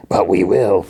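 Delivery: lightly voiced tough threat; Wolverine. Wolverine warning Gambit of a fight, mock menace. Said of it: "Wolverine's like".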